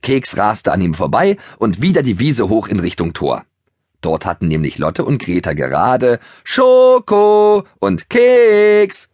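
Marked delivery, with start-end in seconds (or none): 6.4-9.1 calling. Acted. Narrator echoes the girls calling.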